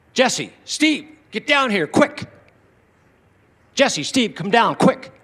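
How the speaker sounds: high, loud urgent call from downstairs. Retold or imitated. Grandfather calling the family down from the basement, loud and urgent. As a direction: yell up the stairs as if something's wrong.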